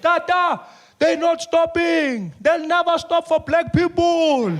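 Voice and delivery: high, slow, desperate pleading; a panicked aide begging the old man; panicking Siphiwe. Acted. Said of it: "Spew is there".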